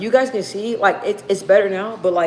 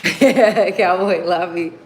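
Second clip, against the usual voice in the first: usual speaking voice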